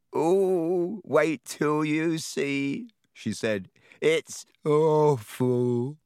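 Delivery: slow, drawn-out delighted ghost voice; a ghost relishing someone's misery; gleeful ghost. Acted.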